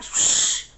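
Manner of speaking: long breathy shh; imitated shushing. Friend silencing her as phone rings, imitated shush. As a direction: hush someone sharply.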